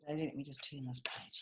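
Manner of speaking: ordinary delivery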